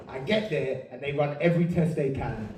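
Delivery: ordinary delivery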